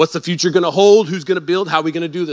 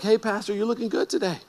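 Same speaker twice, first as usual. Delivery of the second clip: soft, higher, flirty churchgoer voice; flirty lady; 4 semitones higher, 8 dB quieter than usual. Retold or imitated. Pastor imitating a woman at church, soft and flirty. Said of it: "was like".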